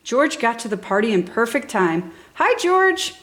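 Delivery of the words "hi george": put-on high, cheerful child's greeting call; a little girl waving a friend in. A narrator's reading. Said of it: "called out the little girl".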